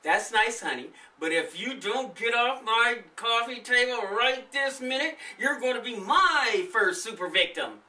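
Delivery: loud, stern mom threat; a mom ordering him off the table; mother shouting. Acted. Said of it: "Nathan's mother shouted".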